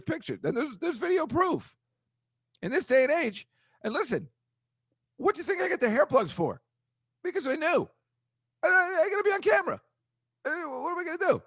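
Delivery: way up high and squeaky-light, jokey; a comic high voice he doesn't know why he's doing; funny high voice. Acted. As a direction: pitch the whole rant up into a silly falsetto. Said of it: "I don't know why I'm talking like this".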